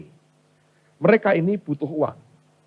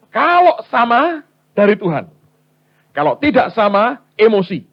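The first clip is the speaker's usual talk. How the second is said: "sama" and "emosi" punched high and loud; emphatic ruling; 7 semitones higher, 6 dB louder than usual. Acted. Husband setting the test for his wife, "sama" and "emosi" stressed high and loud.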